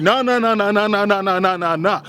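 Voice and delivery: rapid-fire nahs starting high and sliding down; cutting someone off. Retold or imitated. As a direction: talk over him with a run of nahs. Acts out cutting the coach off.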